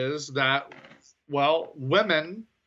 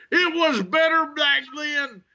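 much higher, louder mock grumbling nostalgic voice; grumpy old fan; 12 semitones higher, 5 dB louder than usual. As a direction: play the fan who hates anything new. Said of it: "I really am turning into that guy".

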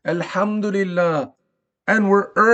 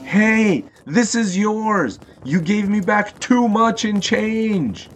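dad calling out, words drawn out; mild acted shout, stretched out. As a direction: call from a car window to someone some way off. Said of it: "rolled down the windows and shouted".

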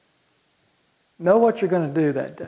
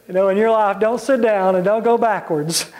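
normal talking voice

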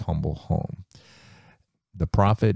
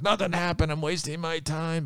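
high, softer frustrated complaint; frustrated whine; 11 semitones higher, 4 dB quieter than usual. Acted. Naaman to his servant after six dips: high frustrated whine. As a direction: whine that it's pointless.

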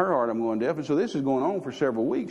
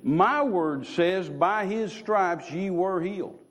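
slow, weighty, measured pronouncement; voice of God; 6 semitones higher, slower than usual. Retold or imitated. God corrects the doubting preacher, slow and weighty. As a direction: lay it down slowly, like scripture. God explains the rebuke slowly.

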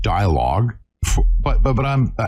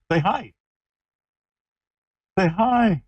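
high, sing-song puppy talk; 6 semitones higher than usual. Real, not acted. Cooing at the puppy.

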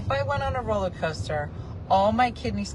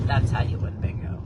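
whispered approval; 6 semitones lower than usual. Retold or imitated. Approving aside.

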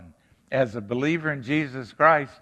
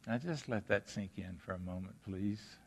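soft, hushed invitation to reflect; 4 semitones lower, 16 dB quieter than usual. Real, not acted. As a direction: drop the voice way down and let it land. Preacher pauses for reflection.

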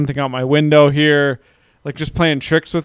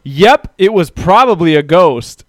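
drawn-out, louder mock agreement; raised, slowed-down sarcastic retort; 2 semitones higher, 5 dB louder, slower than usual. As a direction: agree slowly with heavy sarcasm. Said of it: "I very sarcastically went".

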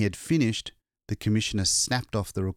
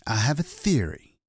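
ordinary delivery, nothing marked

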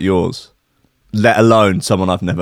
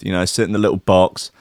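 usual speaking voice

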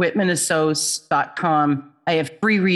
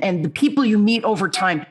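ordinary delivery

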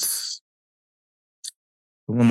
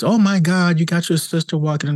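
mom's excited praise; 9 semitones higher than usual. Retold or imitated. Mom excitedly praising her child for helping her sister walk. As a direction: gush it like a proud mother. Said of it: "your mom's gonna praise".

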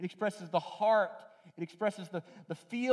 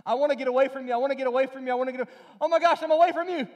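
high and loud, speeding into panic; a kid shouting away then suddenly scared; hammed-up toddler realising he's alone; runaway toddler; 7 semitones higher, 6 dB louder, much faster than usual.

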